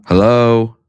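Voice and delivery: loud repeated hello, checking the mic; calling out. Real, not acted. Streamer calling to his muted co-host, loud. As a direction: call out to see if anyone can hear. Checking if partner hears him.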